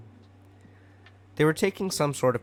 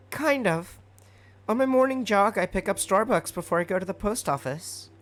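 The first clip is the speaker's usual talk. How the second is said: put-on high, eager young hero voice; cheerful boy voice; 10 semitones higher than usual. A narrator's reading. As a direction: answer brightly, like an earnest kid. Midoriya's cheerful reply.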